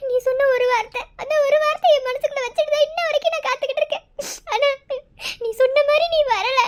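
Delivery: sobbing between phrases; tearful pleading. Acted. Woman waiting for her absent lover, tearful pleading. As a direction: cry through the words, breaking off to sob.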